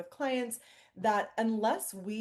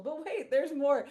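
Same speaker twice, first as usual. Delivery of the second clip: higher, punchy TV salesman pitch; infomercial voice; 6 semitones higher, 3 dB louder than usual. Pretend. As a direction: sell it like a late-night infomercial host. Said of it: "I know I sound like an infomercial".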